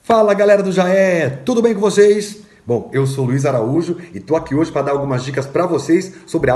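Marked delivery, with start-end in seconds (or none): 0.0-2.5 loud, high, excited hello to the gang; high-pitched, punchy shout-out; hyped greeting. Real, not acted. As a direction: greet the whole crowd with big energy. Hyped greeting to the project group.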